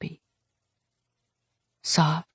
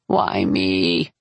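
weary lament; 9 semitones lower than usual. Acted. Heroine laments after aunt's visit.

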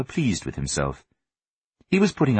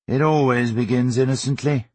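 weary fatherly sigh, pitched up; 5 semitones higher than usual. A narrator's reading. Father sighing over his son's excuses, wearily. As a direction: say it as a resigned sigh. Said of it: "Mister Swift sighed".

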